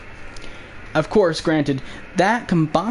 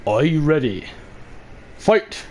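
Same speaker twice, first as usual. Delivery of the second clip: booming fighting game announcer; slower than usual. Pretend. Mortal Kombat announcer parody.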